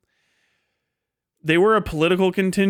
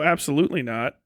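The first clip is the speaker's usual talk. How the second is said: normal talking voice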